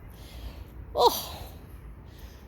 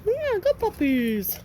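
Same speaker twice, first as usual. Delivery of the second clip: slow puppy coo; 7 semitones higher, much slower than usual. Real, not acted. Praising puppies.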